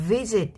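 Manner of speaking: "visit" stressed on the first syllable; teacher's demo, "visit" front-stressed. Shown as a demonstration. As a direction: lean on the opening of "visit". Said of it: "앞에 강세를 뒀기 때문에".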